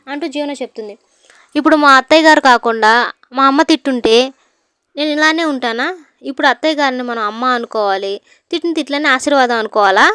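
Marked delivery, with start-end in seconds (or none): 1.6-10.0 loud, indignant complaint about being scolded; a daughter-in-law's loud hurt protest; upset outburst. Acted. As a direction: push back hard, louder, voice raised in protest.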